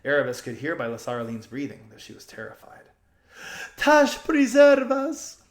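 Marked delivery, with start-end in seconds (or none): 3.8-5.4 frightened cry. A narrator's reading. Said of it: "whispered Lasaraline".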